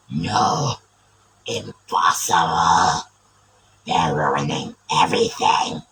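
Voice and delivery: deep, booming villain shouts; Galvatron voice. Pretend. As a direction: roar commands like a giant evil robot. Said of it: "a Galvatron, I guess".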